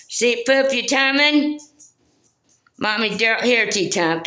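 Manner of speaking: high sing-out to the dog; pet-calling voice. Real, not acted. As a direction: call the dog like a doting mommy. Calling the dogs over.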